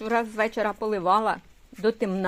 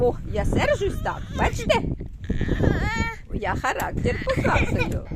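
higher mock-cross little-child voice; voicing the grumpy baby; 9 semitones higher than usual. Mother speaking for her fussing child in a higher mock-cross voice. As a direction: pretend to be the sulky toddler, pitched up.

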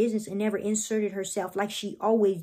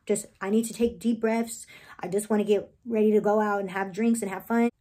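usual speaking voice

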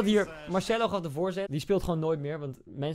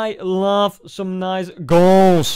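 hammed-up English line; 10 dB louder, much slower than usual. Reacting to goals, hams English line.

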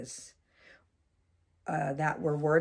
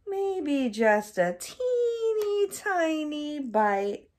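much higher, slow wheedling squeak; pleading little voice; 11 semitones higher, 5 dB louder, much slower than usual. Acted. Crocodile wheedling for one more bite in a higher squeak. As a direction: beg for just one bite.

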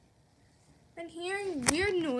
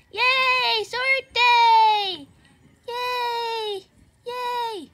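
excited cheer; 3 semitones higher, 6 dB louder, much slower than usual. Acted. Teacher cheering.

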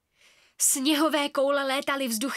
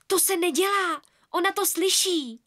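aghast; 6 semitones higher, 3 dB louder than usual. Acted. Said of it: "zajíkla se Ela zděšeně".

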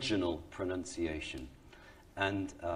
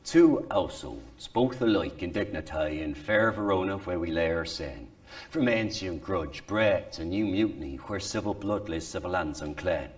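lower-pitched, reconstructed Shakespearean English accent; original pronunciation; 3 semitones lower than usual. Shown as a demonstration. Actor showing how Shakespeare's company sounded, original pronunciation. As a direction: recite in earthy original pronunciation, like West Country mixed with Irish. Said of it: "as close as we can get to what Shakespeare's accent".

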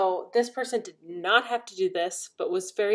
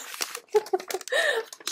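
squealing laugh, much higher; high delighted laugh; 14 semitones higher than usual. Real, not acted. Thrilled by the gift, a high squealing laugh. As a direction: burst into a high giggle.

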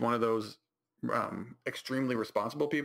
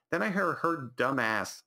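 ordinary delivery

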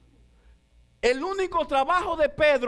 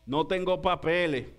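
mock worried lament; 6 semitones lower than usual. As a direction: moan it like a broke fisherman. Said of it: "Pedro pensando y diciendo".

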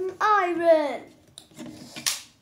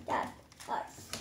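voiceless, much softer hurting whisper; whispered pain; 12 dB quieter than usual. Pretend. Injured pretend hero whispers to himself, weak and soft. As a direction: breathe it out weakly, hurt. Toy hurt in pretend fight.

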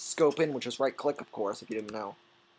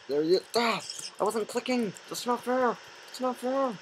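whiny protest; 10 semitones higher than usual. Real, not acted. Whining at game unfairness.

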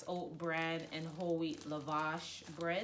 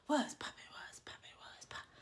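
breathy hush, trailing off to nothing; whispered greeting; 7 semitones higher, 5 dB quieter than usual. Pretend. Vlogger teasing viewers, greeting fading to a whisper.

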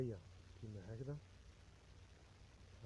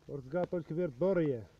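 loud hawking call; 8 dB louder than usual. Acted. Vendor announcing item.

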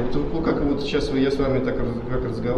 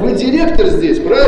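high, loud demanding shout; 9 semitones higher, 12 dB louder than usual. Real, not acted. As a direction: shout the question at him. Heated quarrel with manager.

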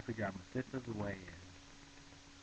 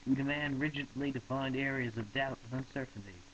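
louder, higher shouted demand; shouting; 5 semitones higher, 5 dB louder than usual. Acted. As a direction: proclaim it like a protest slogan. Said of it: "shouted Broomfondle".

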